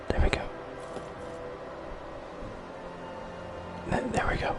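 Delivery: quiet unvoiced whisper. Real, not acted. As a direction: keep it hushed. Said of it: "I had to whisper".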